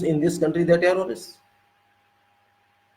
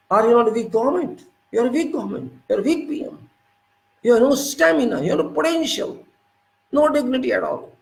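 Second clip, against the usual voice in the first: angry scolding; 6 semitones higher than usual. Real, not acted. Commentator to the prime minister, accusing him of weakness, heated high-pitched scolding. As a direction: voice rising high, hammering each accusation.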